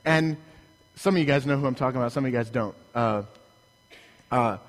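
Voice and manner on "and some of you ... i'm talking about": ordinary talk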